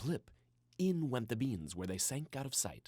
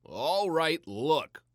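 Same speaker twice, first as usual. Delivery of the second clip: louder, slightly higher announcing voice; 4 semitones higher, 8 dB louder, much slower than usual. A narrator's reading. Papa announcing to the bored family, lightly voiced and louder. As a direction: call the room to attention. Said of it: "Papa announced".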